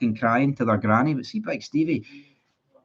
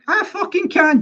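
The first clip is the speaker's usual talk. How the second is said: defiant retort; 4 dB louder than usual. Retold or imitated. To a fan at Ibrox who says he can't criticise the team, a mock shout of defiance. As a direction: pitch jumps way up, a sharp, hammed-up shout of defiance. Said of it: "I'd be like".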